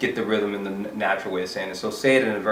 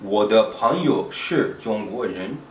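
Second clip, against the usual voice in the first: slow, deliberate learner's take, each syllable placed; slow and careful; slower than usual. Shown as a demonstration. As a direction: say each syllable slowly and clearly. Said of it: "it's good to say it slowly a few times".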